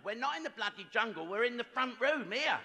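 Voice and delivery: high, increasingly loud correction; mock angry retort. Wife correcting her husband about the jungle, mock angry and rising. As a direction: build in pitch and volume to an exasperated finish.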